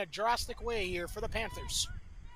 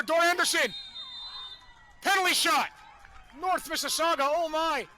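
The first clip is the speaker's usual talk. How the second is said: rising, louder rush of live commentary; excited play call; 3 semitones higher, 6 dB louder than usual. Real, not acted. Commentator calling a shot off the post, excited and loud. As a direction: call the chance as it unfolds, higher and louder.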